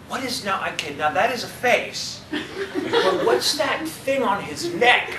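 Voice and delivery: high, slow, laughing puzzled mother; puzzled mom. Retold or imitated. Mother puzzled over an anime drawing, high and laughing. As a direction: squint at the screen like an anime newcomer. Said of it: "she asked me the question".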